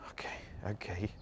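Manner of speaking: soft breathy whisper; whispered giving in. Real, not acted. Bracing herself to walk up front, whispered. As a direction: resign yourself under your breath.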